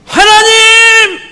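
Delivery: a desperate prophet's slow, stretched shout to heaven; crying out to God. Shown as a demonstration. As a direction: hold the cry to God long and loud at full voice. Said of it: "아마 이렇게 했겠죠".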